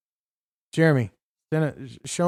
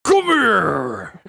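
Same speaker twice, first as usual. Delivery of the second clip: growled game villain call; hammed-up ninja growl; Scorpion; 4 semitones higher, 5 dB louder than usual. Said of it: "that voice sounded like Scorpion".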